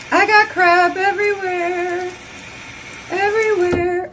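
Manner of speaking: high, slow whine over the mess; a comic high-pitched whine about the mess; mock dismay. Real, not acted. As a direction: moan about the mess in a put-on voice. Mask cream smeared everywhere.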